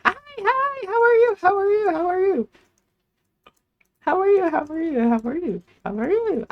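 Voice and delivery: very high, loud singsong hello; cheerful cashier greeting. Real, not acted. Cashier greeting a customer, high bright singsong. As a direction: beam at the customer as you greet.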